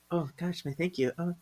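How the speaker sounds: very soft, bashful reply; flustered modest thanks. Acted. Phoebe answers gushing fan, soft and flustered. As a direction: shrink back, murmuring it softly.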